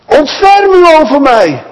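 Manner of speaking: high, slow, drawn-out cry for help; calling out loud. Acted. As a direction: cry out to Jesus as loud as you can. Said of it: "Zo hard hij kan, roept hij". (luid en hoog smekend roepen)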